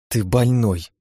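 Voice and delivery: lightly grumbled reproach; displeased grumble. Acted. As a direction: grumble it, annoyed. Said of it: "недовольно буркнула Лия".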